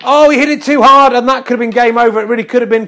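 high, loud burst over a missed chance; excited commentary. Pretend. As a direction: exclaim at a shot gone wrong. Commentator reacts to costly miss.

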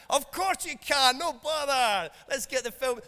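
high, loud hammed-up pushover dad; mock soft-touch dad. Dad joking about saying yes, hammed-up and loud. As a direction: play the dad who says yes to everything. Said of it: "I'm only joking".

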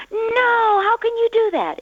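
very high, loud crying wail; upset child. Pretend. Child crying out at the parent over the screen, high wailing. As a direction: wail like a kid losing the screen. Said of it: "Your child might say".

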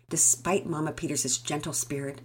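ordinary delivery

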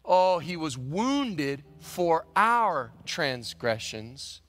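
slow, drawn-out scripture reading. Acted. Preacher reciting Isaiah to the congregation, slow and solemn. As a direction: savour each word like a sacred text. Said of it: "the Bible says what".

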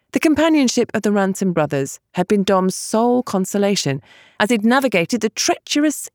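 ordinary delivery

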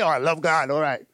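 mock offhand. Mocking lip service to God, offhand. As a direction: toss it off without meaning it. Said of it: "we just say".

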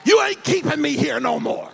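high, slow shouted preaching; rousing the congregation to a shouted climax; preaching at full force. Acted. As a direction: drive it home to the church.

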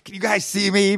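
put-on high, loud playful kid voice. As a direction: squeal it like a kid at a periscope. Voicing her at the periscope.

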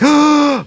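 sharp theatrical gasp; mock dramatic gasp. Mocking the show's twists with a gasp. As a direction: gasp like a soap opera twist. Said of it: "every turn being like".

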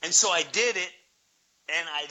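ordinary delivery

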